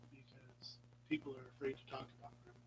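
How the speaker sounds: confiding whisper. Real, not acted. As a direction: let them in on a secret. Confiding why she talks.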